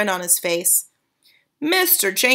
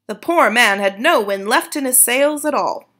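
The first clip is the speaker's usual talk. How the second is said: ordinary delivery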